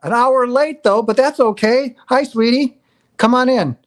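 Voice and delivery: loud, high welcome to a latecomer; calling out greeting. Real, not acted. Host greeting Vanessa arriving an hour late, calling out.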